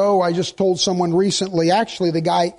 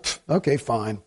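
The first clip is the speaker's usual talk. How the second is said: dismissive scoff; 10 semitones lower, 6 dB quieter than usual. Retold or imitated. Dismissive inner reaction.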